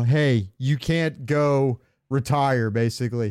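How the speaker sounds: normal talking voice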